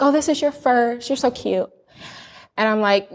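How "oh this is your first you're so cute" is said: imitating a patronizing nurse, pitched higher; mock sweet talk-down to a first-time mom; a nurse doting on a naive patient, mildly patronizing; condescending nurse. Nurse condescending to pregnant woman.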